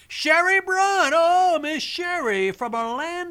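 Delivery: slow, high showman announcement; drawn-out announcer greeting. Real, not acted. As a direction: stretch the caller's name out grandly. Host greets caller with flourish.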